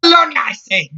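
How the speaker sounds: high, rising Scots outburst; indignant shout. Acted. Scotswoman refusing to let Jack pass, indignant shouting. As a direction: build to a loud, high-pitched cry. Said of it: "she shouted at Jack".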